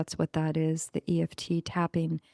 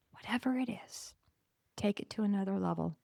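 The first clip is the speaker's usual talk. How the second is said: whispered; 10 dB quieter than usual. Real, not acted. Whispered advice.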